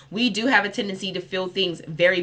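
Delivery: ordinary talk, nothing marked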